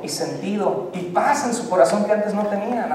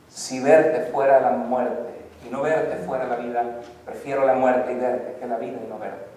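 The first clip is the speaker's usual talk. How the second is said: lower, softer, tender verse; romantic recital; 6 semitones lower, 5 dB quieter than usual. Acted. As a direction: murmur it like a serenade. Reciting love poem.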